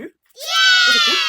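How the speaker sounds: loud, high-pitched "yeah" yell; celebratory cheer. Real, not acted. Vlogger celebrating the replies she got, high cheering yell. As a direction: whoop in triumph.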